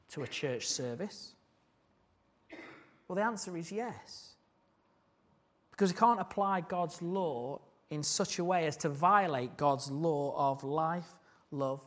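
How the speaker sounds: ordinary talk, nothing marked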